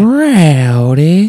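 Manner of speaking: shouted show name. Acted. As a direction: yell it like a hype man.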